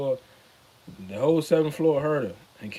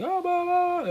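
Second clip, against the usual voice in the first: much higher babbling noise; imitated babble noise. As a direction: mimic a jumble of voices, way up high. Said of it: "a whole bunch of noise".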